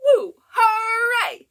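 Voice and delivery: high, loud, laughing woo hooray; excited cheer. Acted. Teacher cheering with the kids in the song. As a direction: let the cheer burst out. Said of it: "do all three".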